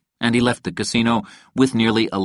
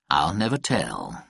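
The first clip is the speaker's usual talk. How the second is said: low, slow and soft, with cool reserve; understated cool refusal, pitched down; coolly; 6 semitones lower, 5 dB quieter than usual. A narrator's reading. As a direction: keep it cool, quiet and unhurried. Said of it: "Fitch said coolly".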